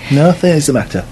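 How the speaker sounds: distant, off-mic voice; leaning back away from the mic; far from mic. Real, not acted. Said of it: "You sound as if you're sitting back".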